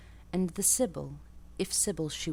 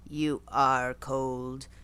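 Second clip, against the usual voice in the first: very deliberate; 5 semitones lower, much slower than usual. A narrator's reading. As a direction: lay each word down slowly like a verdict. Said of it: "said very deliberately".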